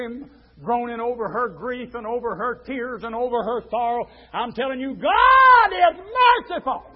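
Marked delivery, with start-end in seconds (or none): 4.9-6.8 loud, raised, drawn-out pulpit cry; full-force preaching. Real, not acted. Preacher tells of two grieving women, full-force preaching. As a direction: belt it out to the congregation.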